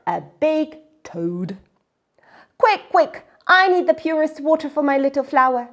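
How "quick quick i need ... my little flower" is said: high, loud and fast; hurried, eager. Acted. Miyuki hurrying to the well, high and fast. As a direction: rush the words out breathlessly eager.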